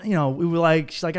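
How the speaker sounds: ordinary talk, nothing marked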